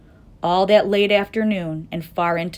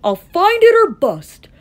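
crying out; 8 dB louder than usual. A narrator's reading. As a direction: swear it at full voice. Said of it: "cried Horton".

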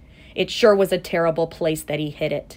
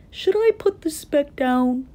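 high-pitched worried question; alarmed; 9 semitones higher, 4 dB louder than usual. A narrator's reading. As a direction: wonder aloud in mild alarm. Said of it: "Horton thought with alarm".

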